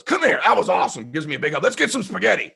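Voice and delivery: father praising his son after the fight, excited; proud dad. Retold or imitated. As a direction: cheer your son on. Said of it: "my dad goes".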